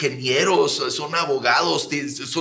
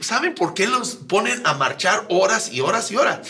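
ordinary delivery

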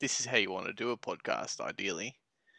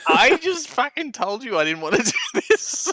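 louder and much higher, laughing through the protest; a real laugh breaking through mock outrage; laughing exasperation; 13 semitones higher, 9 dB louder than usual. Real, not acted. Protesting to the host who made him guess, laughing loudly.

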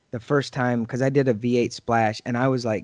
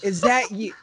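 raised mother's question; mom's voice; 6 semitones higher than usual. Retold or imitated. Mother asking her son if it's him, raised surprise. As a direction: ask like an amazed mom. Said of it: "She goes".

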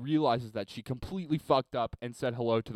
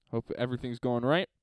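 ordinary talk

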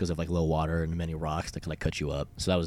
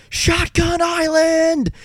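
long, high, drawn-out holler; full-voiced rallying cry, stretched out; imitated shout; 10 dB louder, much slower than usual. Friend calls the group to a river stop, shouting. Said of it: "would always shout".

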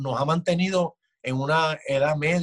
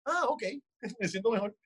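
naive relief; 5 semitones higher, 6 dB quieter than usual. Retold or imitated. Voicing relieved believer.

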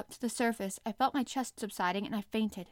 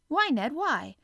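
eager; 5 semitones higher, 6 dB louder, slower than usual. A narrator's reading. Eager question to Ned.

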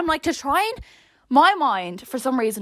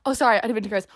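normal talking voice

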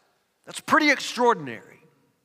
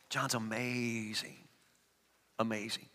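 awed hush; 10 semitones lower, 16 dB quieter than usual. Real, not acted. Awed soft aside.

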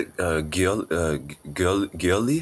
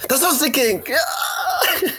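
excited shout; 11 semitones higher, slower than usual. Real, not acted. Knew the answer too late.

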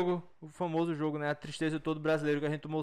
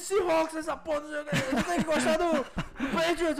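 imitating angry friend; 8 semitones higher, slower than usual. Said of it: "O Davi ficou puto".

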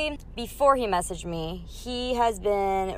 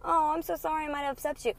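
mock sympathy; 8 semitones higher, 4 dB quieter, faster than usual. Mock sympathetic reply she rejects.

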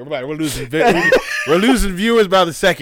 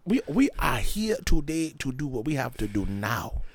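careful, formal mock African accent; African-accent parody, soft and declarative; 5 semitones lower, 7 dB quieter than usual. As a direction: put on an African accent, evenly spoken. Imitating African speakers mid-joke.

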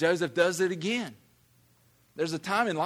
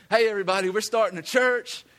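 louder and higher calling out; announcing to town; 6 semitones higher, 6 dB louder than usual. Retold or imitated. Preacher imagines shouting his new church plan through town. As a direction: call it out down the street. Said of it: "running through town going".

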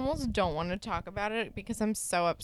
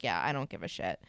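much lower, flat dismissal; deadpan Miss H; 14 semitones lower, 3 dB quieter, faster than usual. Retold or imitated. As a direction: drop low and brush them off. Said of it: "she's like".

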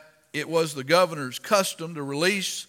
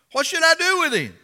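high, raised-voice plea to a crowd; exasperated demand; 12 semitones higher, 7 dB louder, faster than usual. Acted. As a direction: demand it loudly, pitched right up. Pilate pleads with the crowd.

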